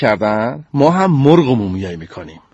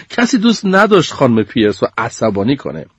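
plain reading voice

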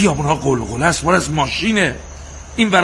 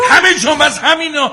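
high-pitched, loud raving; angry rant; 11 semitones higher, 6 dB louder than usual. Acted. Husband raging that they are everywhere, high loud rant. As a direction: rave on over the calming voice. Rant goes on over wife's pleas.

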